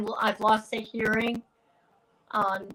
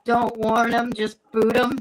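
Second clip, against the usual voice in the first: usual speaking voice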